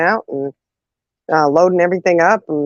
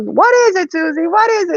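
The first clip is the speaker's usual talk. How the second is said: mom answering; 13 semitones higher than usual. Retold or imitated. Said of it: "She goes".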